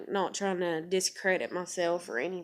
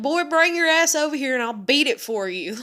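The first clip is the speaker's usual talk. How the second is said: loud, high, fired-up threat; heated mock threat, raised voice; retold mad threat; 7 semitones higher, 7 dB louder than usual. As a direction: re-enact telling him off, loudly. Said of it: "I was so mad".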